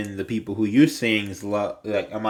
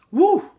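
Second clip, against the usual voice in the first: breathy woo; 7 dB louder than usual. Real, not acted. After a coughing fit.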